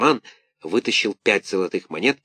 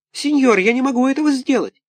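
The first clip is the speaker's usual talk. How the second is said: put-on very high, wailing small-boy voice; pitiful little puppet; 4 dB louder than usual. A narrator's reading. Buratino pleads with Karabas, high wailing. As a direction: wail like a poor orphan puppet.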